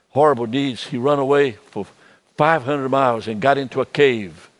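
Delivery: usual speaking voice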